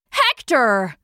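mild cry of discovery; urgent cry. A narrator's reading. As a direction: cry out the name on spotting him. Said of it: "he cried".

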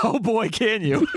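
high-pitched, playful put-on enthusiasm; hammed-up eager reply; mock delight. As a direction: play the thrilled producer. Imagined producers answer eager actor.

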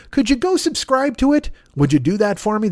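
high, pleading little ask. Pretend. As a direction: beg sweetly for subscribers. Begging viewers to subscribe.

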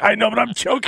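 high pinched strangled voice; strained high choke voice. Retold or imitated. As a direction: squeeze it out while choked. Voicing strained choked friend.

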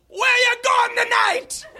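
squeaky shouted question; shrill mock cab driver voice; high-pitched shouting cabbie. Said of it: "he was like".

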